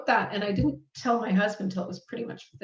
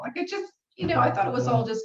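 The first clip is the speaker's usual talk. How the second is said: ordinary talk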